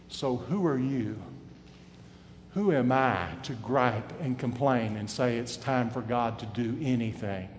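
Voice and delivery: ordinary delivery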